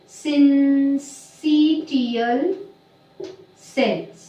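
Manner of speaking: syncytial stretched out very slowly; slow term dictation. Shown as a demonstration. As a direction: sound out the new word piece by piece. Teacher writes out new term.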